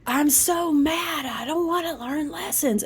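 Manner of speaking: imitating a sulky grumbler; mock sulking. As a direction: pout like a grumpy kid. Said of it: "it's very third dimensional to say".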